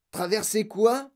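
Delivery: high, drawn-out bewildered question; mild yell of disbelief; alarmed yell. A narrator's reading. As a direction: cry out, baffled. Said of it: "hurla grand-papa Joe".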